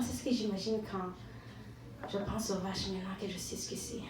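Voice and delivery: normal talking voice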